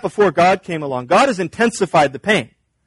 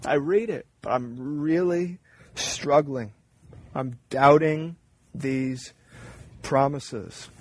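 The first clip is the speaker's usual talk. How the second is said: quiet confession; 3 semitones lower, 7 dB quieter than usual. Retold or imitated. Preacher voicing a struggling believer, hushed. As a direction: murmur the doubt under your breath. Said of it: "You struggle like this?".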